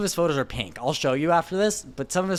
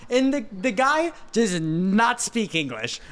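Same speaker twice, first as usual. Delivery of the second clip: usual speaking voice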